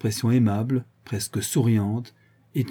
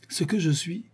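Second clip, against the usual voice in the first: put-on higher, reedy old druid's surprised question; cracked, quavering old voice; 6 semitones higher, 4 dB quieter than usual. A narrator's reading. Old druid echoes the intruder's question, quavering. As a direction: quaver it like a bewildered old man. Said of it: "avec une voix éraillée et chevrotante".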